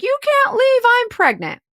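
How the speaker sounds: high-pitched mock pleading; desperate pleading. June Cleaver begging him not to leave, high mock pleading. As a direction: imitate a frantic, melodramatic protest. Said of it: "So now she's like".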